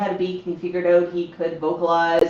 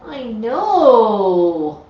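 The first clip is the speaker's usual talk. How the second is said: bright excited exclaim; 3 semitones higher, 8 dB louder than usual. Real, not acted. Praising pet parrot.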